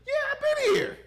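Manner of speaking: high loud shout of recognition; excited shout. Retold or imitated. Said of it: "I was like".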